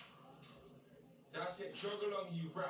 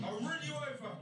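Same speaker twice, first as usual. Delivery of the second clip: angry threat; 8 semitones higher than usual. Acted. Threatens to drive at her.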